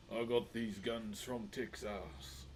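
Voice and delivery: slow Texas drawl, pitched low; Texas accent. Acted. Streamer playing a Texan for a viewer from Texas, slow Texas accent. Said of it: "see someone from Texas, I gotta say it".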